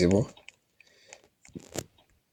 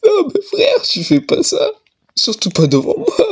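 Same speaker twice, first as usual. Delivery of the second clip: nervous laughter; 9 semitones higher than usual. Real, not acted. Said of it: "c'est un rire nerveux".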